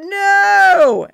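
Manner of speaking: loud, high desperate scream. A narrator's reading. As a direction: scream it as you crash. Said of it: "I screamed a desperate".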